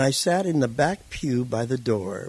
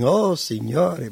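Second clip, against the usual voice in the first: slow, drawn-out, louder prayer to God; fervent Italian prayer; 5 semitones higher, 5 dB louder, much slower than usual. Retold or imitated. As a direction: stretch out each word like an old man praying in tears. Old Italian man praying aloud.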